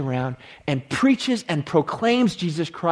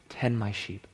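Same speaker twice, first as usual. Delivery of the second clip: guided meditation; 3 semitones lower than usual. Acted. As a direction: guide the listeners inward, hushed. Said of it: "lead us in a bit of an exercise".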